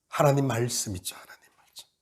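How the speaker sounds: whispered, as if sharing a secret. Real, not acted. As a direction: drop to a whisper to draw them in. Preacher drops to a whisper. (속삭이듯 하나님 말씀)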